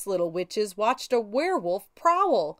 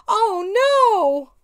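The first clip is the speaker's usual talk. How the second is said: alarm; 10 semitones higher, 8 dB louder than usual. A narrator's reading. Witch broom falling.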